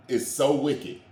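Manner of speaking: normal talking voice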